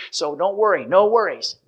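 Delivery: normal talking voice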